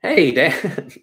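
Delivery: put-on hammy old stage greeting; overplayed vaudeville opener; vaudeville bad acting. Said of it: "Let's deliver it like we're in, in vaudeville".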